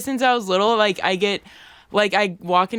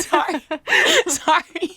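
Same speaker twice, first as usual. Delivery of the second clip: laughing with mouth full; 6 semitones higher than usual. Real, not acted. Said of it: "She can't breathe 'cause she's laughing".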